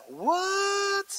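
a high, breathy "What?" in shock; mock disbelief. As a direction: gasp it out, stunned. Preacher reacts to idol worship.

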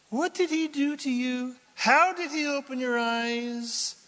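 mock indignant questioning; grumpy religious skeptics. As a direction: needle him like suspicious rule-keepers. Mocking the Pharisees' questions.

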